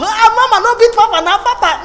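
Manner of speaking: put-on high, loud laughing child's plea; child pleading. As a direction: beg like a small child stopping a fight. Child voice pleading between parents.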